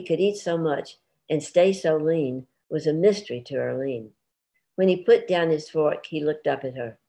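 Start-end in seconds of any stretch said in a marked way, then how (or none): none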